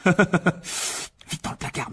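slow, whispered urgency; whispered hurry to hide the elf; urgent whisper. Acted. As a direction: hiss it so nobody downstairs hears. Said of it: "murmura Harry".

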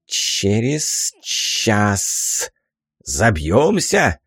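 put-on slow, drawn-out old hag; stubborn old woman, dragging words; old crone voice. Crone insists on her bet.

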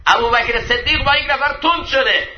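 high, ringing preacher's voice; impassioned preaching. Acted. Preacher introducing Abu Bakr's sharp words, impassioned. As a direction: launch it out to the congregation.

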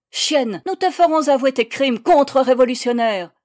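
loud, high insulting shout; furious threat. A narrator's reading. Persecutor threatens the accused before beating her, furious. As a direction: threaten with open fury.

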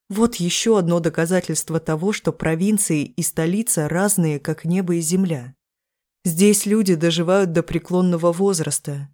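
plain reading voice

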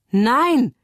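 high, loud cry of refusal; screamed. A narrator's reading. Mia rejects her release, screaming. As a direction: cry out in protest, pitched well up. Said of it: "schreit Mia".

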